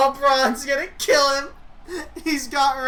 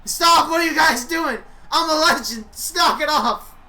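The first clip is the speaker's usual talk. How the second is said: higher, louder indignant yelling; mock screaming; 4 semitones higher, 4 dB louder than usual. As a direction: scream at the chanting crowd. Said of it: "He's screaming".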